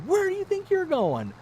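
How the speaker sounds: very high-pitched mock panic; hammed-up frantic wife; freaking out. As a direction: demand an answer in a hysterical squeal. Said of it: "she's starting to freak out".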